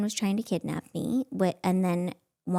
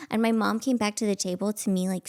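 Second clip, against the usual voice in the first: ordinary delivery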